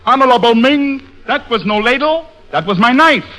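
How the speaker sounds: high-pitched stage Chinese reply; mock Chinese accent. Tong replying to Ming, mock Chinese accent. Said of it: "And Tong replied".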